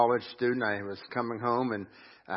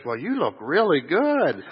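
sweet compliment; 6 semitones higher, 7 dB louder, slower than usual. Retold or imitated. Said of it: "She goes".

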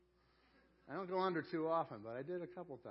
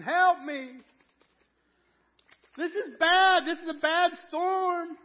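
high, very loud panicked scream; tiny snowman screaming through the storm; screaming for help; 19 dB louder, slower than usual. Acted. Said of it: "he's kind of screaming".

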